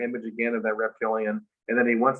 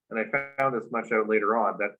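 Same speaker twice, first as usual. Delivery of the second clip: ordinary talk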